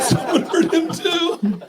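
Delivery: very high, slow squeal; hammed-up falsetto blubber; mock wail. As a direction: parrot it as exaggerated crying. Mimics friend's tearful lyric.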